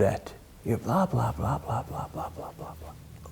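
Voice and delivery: hushed, lightly voiced inner critic; nagging backseat driver. Backseat driver in the head running someone down, lightly acted quiet nagging. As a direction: grumble softly like a critic in your head, fading out on the chatter. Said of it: "a very interesting backseat driver".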